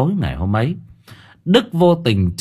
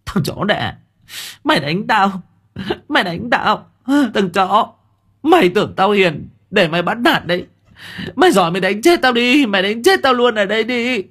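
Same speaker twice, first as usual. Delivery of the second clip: screaming wife; 10 semitones higher than usual. A narrator's reading. Wife to husband before the family, shrieking defiance. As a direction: dare him to kill her, shrieking. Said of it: "giống lên".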